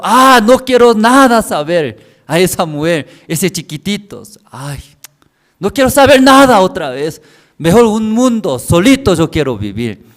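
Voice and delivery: loud, high-pitched exasperation; acted fed-up outburst. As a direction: cry out as if you've had enough of everyone. Acting out a fed-up husband's outburst.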